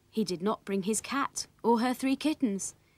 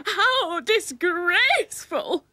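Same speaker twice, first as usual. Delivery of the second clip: high, loud, drawn-out shriek; shrieking storybook indignation; screamed outrage; 10 semitones higher, 7 dB louder, much slower than usual. A narrator's reading. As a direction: scream it slowly like a scandalised aunt. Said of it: "screamed Willie's aunt".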